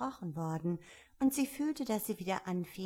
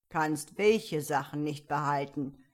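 firm, louder caterpillar; lightly voiced commanding caterpillar; caterpillar character voice; 3 semitones lower, 7 dB louder than usual. A narrator's reading. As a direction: question Alice as a stern caterpillar. Caterpillar questions Alice.

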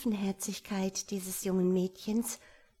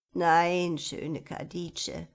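put-on old man; 5 dB louder, much slower than usual. A narrator's reading.

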